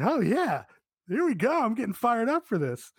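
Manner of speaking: high-pitched, fired-up reaction; pumped, pitch jumping up without shouting; reliving getting hyped. Retold or imitated. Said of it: "This fucking got me hyped".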